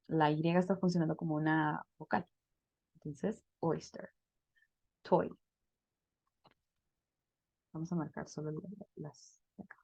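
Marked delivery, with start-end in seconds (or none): 3.6-5.3 "oyster" and "Toy" with oy said like "oi"; English oy sound. Shown as a demonstration. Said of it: "yo aquí digo oyster".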